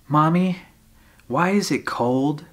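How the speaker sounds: lightly voiced child asking mother; child calling Mommy.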